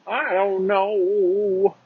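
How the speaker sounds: high, drawn-out, singsong "I don't know"; silly high voice. Pretend. Goofing on his own uncertainty, silly high drawl. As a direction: jump way up and stretch the last word.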